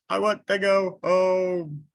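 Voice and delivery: high, loud wail. Acted. Bear after the wave wails to go home. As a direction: wail it like a miserable child.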